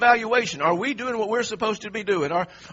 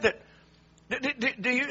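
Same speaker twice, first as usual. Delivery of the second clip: normal talking voice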